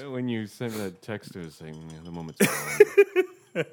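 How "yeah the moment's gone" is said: slow, loud mock-wistful quote; slow, louder mock wistful sigh; mock melancholy. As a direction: ham up the moment's gone. Quoting friend's text.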